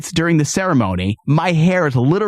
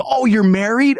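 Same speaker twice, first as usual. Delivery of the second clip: higher, surprised retold question; mock surprise; 6 semitones higher than usual. Barber hears the client is married, mock surprise.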